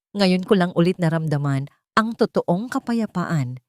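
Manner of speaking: plain reading voice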